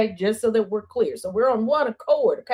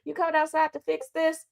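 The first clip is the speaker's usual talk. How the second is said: high, soft mock neighbour calling; mock-sweet request at the door, pitched up; imitated pleading caller; 8 semitones higher, 5 dB quieter than usual. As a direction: lift it high and lighter, mimicking a caller.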